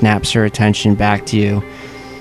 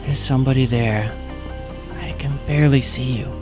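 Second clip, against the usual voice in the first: tiny voice; 3 semitones higher, 6 dB quieter than usual. Acted. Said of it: "you hear a tiny voice".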